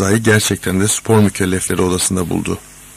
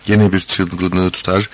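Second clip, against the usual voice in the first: ordinary delivery, nothing marked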